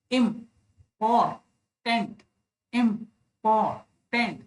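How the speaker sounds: "इम्पोर्टेंट" slowly split into im, por, tent; three spaced beats in "इम्पोर्टेंट", slow and distinct; syllable by syllable. Shown as a demonstration. Teacher showing three jaw touches, syllable by syllable. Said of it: "तो तीन बार मेरी जो jaw है मेरे हाथ से touch हो रही है".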